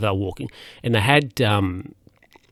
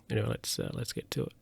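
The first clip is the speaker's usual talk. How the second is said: whispered mutter; 14 dB quieter, faster than usual. Real, not acted. Drops to an unvoiced mutter.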